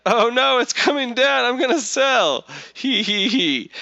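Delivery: high, loud whiny panic; squealed mock dread ending in whimpers. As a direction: mimic a panicking trader, hammed-up. Mocking panicky weak-hands traders.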